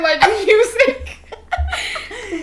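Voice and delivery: slow, high laughing word; drawn-out laughing. Real, not acted. Defending her journal to her friend, slow and laughing. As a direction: stretch it out laughing.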